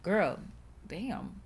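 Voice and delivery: ordinary talk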